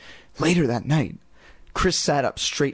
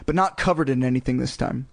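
usual speaking voice

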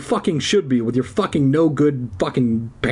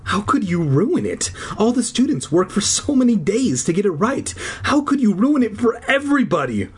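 upset teacher; 9 semitones higher than usual. Acted. Accusing teacher in story.

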